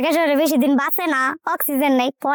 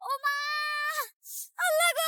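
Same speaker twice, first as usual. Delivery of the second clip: distant wailing; 11 semitones higher, 11 dB quieter than usual. Acted. Wife staging a fall by the pond, high wailing. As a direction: cry out as if badly hurt.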